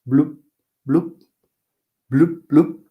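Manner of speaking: high bubbly fish bloops. Shown as a demonstration. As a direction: pop each bloop like a bubble. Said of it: "sound this creature makes".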